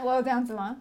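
ordinary talk

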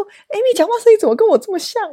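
mother exclaiming that Amy sounds like her; friend's mother quoted. Retold or imitated. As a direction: act out the mother's surprise. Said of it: "他说".

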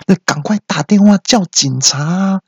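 high-pitched urgent call; alarmed bystander. Acted. Bystander wanting the police called, alarmed. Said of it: "旁边就有人说".

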